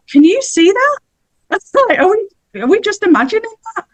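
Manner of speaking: high, wondering voice, as if seeing things; high, astonished and unsure of own eyes; amazed disbelief. Retold or imitated. Amazed at swallows, maybe hallucinating.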